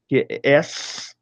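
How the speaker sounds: S named "es", slow and soft; German letter S. Shown as a demonstration. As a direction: say the German S slowly, "es". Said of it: "Eu tenho a letra S".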